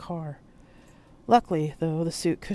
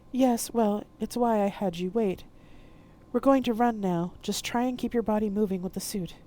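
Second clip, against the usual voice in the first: a hint of a brisk guide, a bit higher; urgent but composed instruction; lightly voiced Frida; 6 semitones higher, 4 dB louder than usual. Frida urging Poe to run, lightly voiced.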